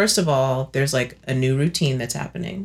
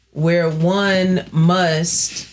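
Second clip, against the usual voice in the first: very slow, weighty mock-formal delivery; mock-formal emphasis; much slower than usual. Real, not acted. Explaining to a friend the bra she needs now, slow mock-formal emphasis. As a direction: draw it out deliberately, as if decreeing a rule.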